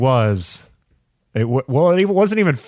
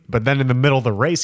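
normal talking voice

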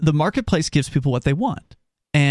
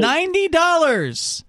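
incredulous; 10 semitones higher, slower than usual. Real, not acted. Host insists on the price.

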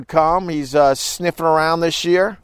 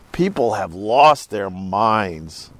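usual speaking voice

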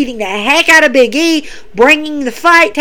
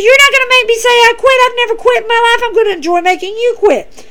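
much higher, mock-defiant rival voice; stubborn rival retort; 11 semitones higher, faster than usual. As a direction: throw it back at her, high and proud. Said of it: "And she says".

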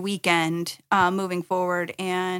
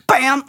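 shouted crash bam; loud bam; 4 semitones higher, 8 dB louder than usual. Pretend. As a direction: punch out a crash sound. Imitated bumper crash.

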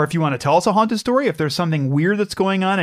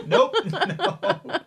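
laughing; 4 dB quieter than usual. Real, not acted. Teasing guess game, laughing.

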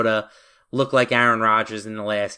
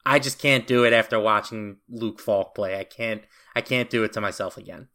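ordinary delivery

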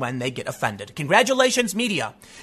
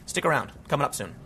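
quiet sign-off; 5 semitones lower, 7 dB quieter than usual. Shown as a demonstration. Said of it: "repeat of sign-off, softer".

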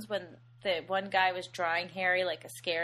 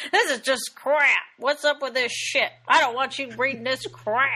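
loud, raised-pitch mock tirade; angry drunken father; 3 semitones higher, 6 dB louder than usual. Co-host imitating the father railing at comic books, angry. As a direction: imitate a ranting, angry movie dad. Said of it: "as his angry drunken father".

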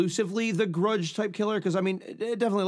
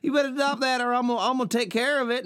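put-on Texas accent; 6 semitones higher than usual. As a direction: brag about it like a Texas trailer wife. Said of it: "can you do a Texas accent of her".

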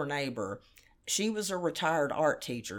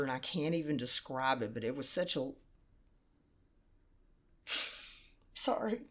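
choked up, trailing into a sigh; holding back tears mid-sentence; quiet grief; 5 dB quieter than usual. Real, not acted. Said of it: "I just miss him so much".

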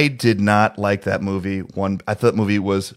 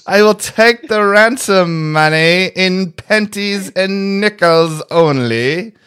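high, slow, louder villain voice; evil genius voice; 10 semitones higher, 7 dB louder, much slower than usual. Pretend. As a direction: hold the ransom demand like a sixties mastermind. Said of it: "Mister Powers".

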